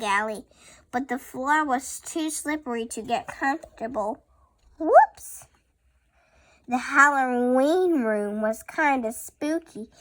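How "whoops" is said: shrieked high whoops; loud high whoops. Acted. Child reads the slip sound, high and loud. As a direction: yelp it like slipping. Sound word read dramatically.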